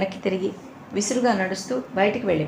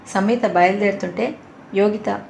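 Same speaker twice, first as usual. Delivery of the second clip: plain reading voice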